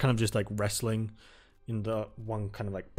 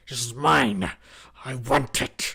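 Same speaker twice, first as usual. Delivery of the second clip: louder, snarling claims of ownership; furious, hammed-up tree creature; possessive tree shout; 2 semitones higher, 6 dB louder than usual. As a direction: roar like a territorial tree. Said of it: "Another one says".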